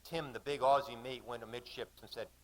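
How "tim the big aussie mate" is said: usual speaking voice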